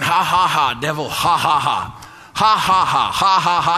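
defiant mocking laugh at the devil; retold laughing. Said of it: "And I started laughing".

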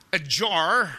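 "jar" in an American accent, with a hard final r. Shown as a demonstration. Australian preacher repeating it for Americans, American accent.

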